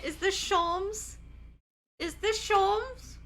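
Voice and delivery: high, slow, rising question; excited disbelief. Real, not acted. Streamer suspecting a favorite character has arrived, excited disbelief. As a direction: draw it out in dawning recognition.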